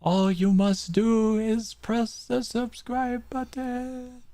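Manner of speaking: high, slow, booming mystic spirit; overacted death scene. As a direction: play a ghostly mentor, hammed up. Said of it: "This guy again".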